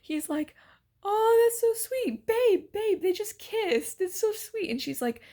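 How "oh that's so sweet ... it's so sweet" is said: high, drawn-out tipsy cooing; slow, high-pitched mock gushing; sloshed gushing guy. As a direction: imitate a drunk moviegoer melting over a cute couple. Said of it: "the sloshed guy next to us, he's like".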